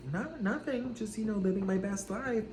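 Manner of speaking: high, soft and airily casual; breezy nonchalance. Retold or imitated. Brushing off her ex with breezy nonchalance. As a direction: brush him off like nothing happened. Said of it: "You know, like this".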